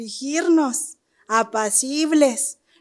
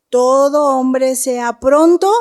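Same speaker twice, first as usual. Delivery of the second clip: louder, slow, rising on "pronto"; emphatic verse; 8 dB louder, slower than usual. Acted. To the brothers, quoting Santiago: loud, stressed reading.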